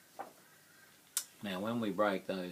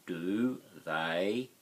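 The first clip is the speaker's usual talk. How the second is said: normal talking voice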